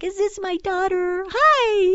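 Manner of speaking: very high, gushing phone greeting; imitating happy dad. Daughter imitating dad when she calls him, high happy excitement. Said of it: "He's all happy".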